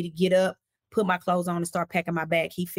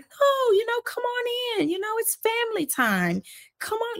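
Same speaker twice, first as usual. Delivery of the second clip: much higher, sing-song coaxing voice; coaxing mom. Retold or imitated. Her mom begging him to come in, high coaxing. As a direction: coax him in like a pleading mother. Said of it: "And my mom's like".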